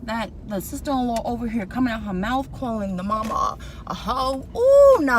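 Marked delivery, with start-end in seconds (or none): 4.6-5.2 high, loud outraged "Ooh, no"; shocked ooh. Real, not acted. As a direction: yelp in disbelief at the insult. Reacts to insult on show.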